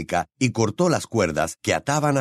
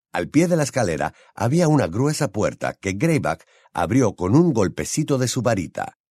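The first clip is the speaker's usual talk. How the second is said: usual speaking voice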